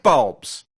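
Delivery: "bulbs" blurted out loud and breathy; hammed-up overstressed "bulbs"; overemphasis. Shown as a demonstration. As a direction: slam the word "bulbs" as a mock rant. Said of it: "a little bit of an overemphasis there".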